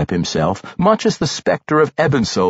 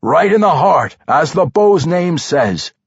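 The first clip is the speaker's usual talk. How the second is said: raised, louder cry of approval; cheering; 5 semitones higher, 4 dB louder than usual. A narrator's reading. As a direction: cheer the shot, high and hearty. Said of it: "Brunner cheered".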